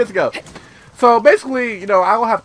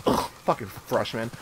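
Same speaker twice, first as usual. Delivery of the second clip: breathy voiceless sneer, much lower and softer; contemptuous sneer; 11 semitones lower, 12 dB quieter than usual. Retold or imitated. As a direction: mutter it with scorn like a snooty upperclassman. Mean girl sneers at them.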